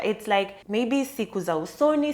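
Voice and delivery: ordinary delivery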